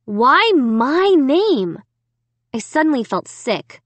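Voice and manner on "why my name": slow, higher, louder anguished question; dismayed. A narrator's reading. Girl reading her name on the walls, drawn-out dismay. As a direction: draw each word out in distress.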